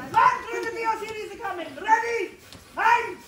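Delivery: captain ordering the cannons fired; battle commands. Acted. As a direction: command the guns at full voice.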